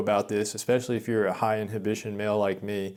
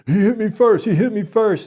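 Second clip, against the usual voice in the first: much higher, louder whiny protest; mimicking frantic guy; 10 semitones higher, 8 dB louder than usual. Imitating the man pleading to the instructor, high and loud. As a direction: squeal it like a guilty kid. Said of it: "And this guy just".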